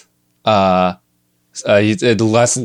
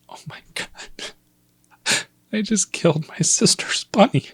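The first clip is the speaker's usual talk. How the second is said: heartbroken whisper; 11 semitones higher, 4 dB quieter, slower than usual. Retold or imitated. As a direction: whisper breaking into a high whimper. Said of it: "and my heart breaks".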